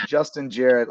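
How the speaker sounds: ordinary talk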